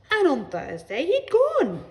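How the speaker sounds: high, loud, drawn-out gossiping neighbour; storytelling neighbour. A narrator's reading. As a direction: relate the pet's death with great drama.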